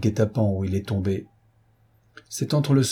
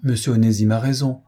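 plain reading voice

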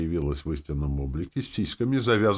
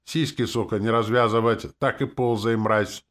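loud, raised angry order; shouting after someone; 6 semitones higher, 5 dB louder than usual. A narrator's reading. Hero kicking the demoness out, shouting after her. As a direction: shout harsh orders at a retreating back. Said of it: "крикнув в спину".

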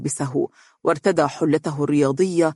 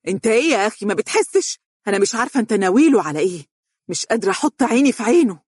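yelling at him; 6 semitones higher, much faster than usual. Acted. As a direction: scream it at him, overwhelmed. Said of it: "صرخت به".

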